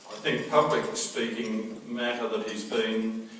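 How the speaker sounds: normal talking voice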